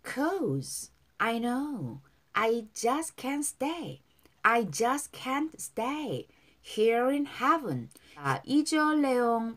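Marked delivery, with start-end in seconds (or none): 0.0-7.9 very slow, drawn-out English phrases; slow model reading. Shown as a demonstration. Teacher models song lyrics for learners, slow and drawn out. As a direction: stretch each word for learners to follow. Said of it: "발음".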